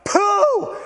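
high, loud blow-up "pooh"; explosion noise. Acted. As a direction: burst out like a blown fuse. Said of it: "it's just like".

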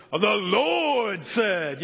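imitating a preacher, hugely drawn out; dad's pastor voice. Imitating dad the pastor proclaiming scripture, preacher voice. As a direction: stretch it like a booming pulpit proclamation. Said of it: "my dad's pastor voice".

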